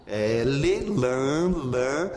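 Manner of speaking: "lam" com o L bem marcado na ponta da língua; L bem pronunciado. Shown as a demonstration. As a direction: destaque o L em "lam", claro e firme. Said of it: "tem um som de L, bem pronunciado". (L nítido e bem articulado)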